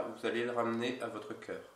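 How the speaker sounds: normal talking voice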